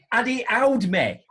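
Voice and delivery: ordinary delivery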